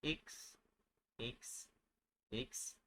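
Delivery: X said as "eeks"; French letter X. Shown as a demonstration. Teacher drilling learners on French X, as "eeks". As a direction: say X short and soft as "eeks".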